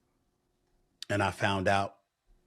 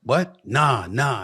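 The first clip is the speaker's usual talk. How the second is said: surprised; 4 semitones higher, 7 dB louder, much slower than usual. Retold or imitated. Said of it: "I said".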